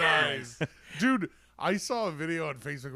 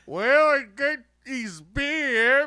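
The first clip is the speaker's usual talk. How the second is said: slow, higher, louder tipsy Aussie bloke; half-drunk Australian accent; 7 semitones higher, 6 dB louder, slower than usual. Retold or imitated. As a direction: slur it like a half-lit pub regular. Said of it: "Australian guys all half-lit going".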